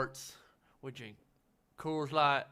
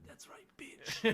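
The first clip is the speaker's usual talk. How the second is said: whispered mock gloat; 7 semitones higher, slower than usual. Friend mocks frat voice.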